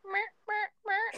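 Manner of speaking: high, soft Chinese goat bleat; Chinese goat accent. Shown as a demonstration. Answering a friend's request for China, high soft Chinese bleat. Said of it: "China, China".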